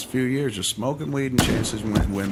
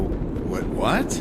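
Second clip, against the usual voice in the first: retold incredulous double take; stunned disbelief; 2 semitones higher than usual. Retold disbelief at brother's shocking news.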